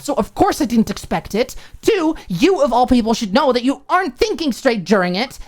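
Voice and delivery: furious roommate scolding Alicia; angry roommate. Acted. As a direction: pile up the accusations. Maud's voiced tirade.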